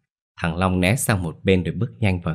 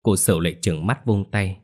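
usual speaking voice

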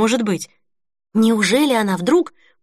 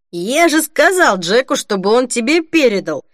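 loud, stumbling over words; slurred drunken outburst; drunk slurring; 4 semitones higher, 5 dB louder than usual. A narrator's reading. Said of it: "Он спотыкался на каждом слове".

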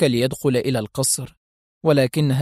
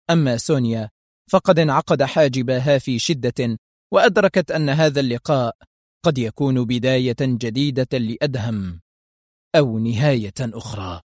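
ordinary delivery

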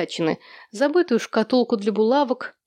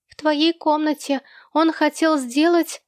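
put-on high, hesitant child voice; high-pitched boy, trailing off uneasily; frightened little boy; 7 semitones higher than usual. A narrator's reading. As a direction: answer big sister in a small high voice. Frightened small boy answers his sister.

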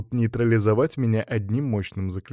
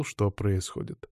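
plain reading voice

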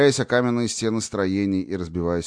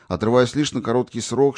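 ordinary delivery

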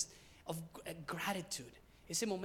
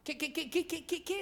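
real stammer on a repeated "qué", pitched high; stuttering; 8 semitones higher than usual. Real, not acted. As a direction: get stuck on "qué" before the question comes out. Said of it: "ya me estoy tartamudeando". (tartamudeo agudo al preguntar)

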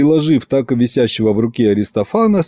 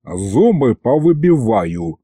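serious warning; slower than usual. Acted. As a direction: warn him flatly and slowly. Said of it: "серьёзно предупредил Аристофан". (серьёзное медленное предупреждение)

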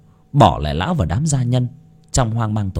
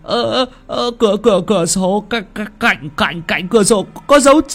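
very high, stuttering shriek building louder; panicked screaming; 4 dB louder than usual. Acted. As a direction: stammer and climb to a high, loud wail. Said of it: "Thằng Tuất tái mặt mà gào lên".

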